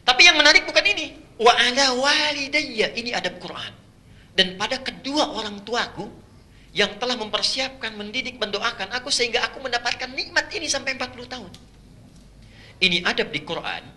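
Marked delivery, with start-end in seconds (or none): 1.4-3.0 high, slow, drawn-out chant; Quran recitation. Acted. Reciting the verse. 4.3-11.5 softer, higher, pleading prayer; tender prayer. Acted.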